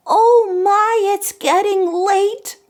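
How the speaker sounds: high-pitched fretting pumpkin; worried little pumpkin. Acted. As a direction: squeak it out like a tiny pumpkin. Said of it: "The first one said".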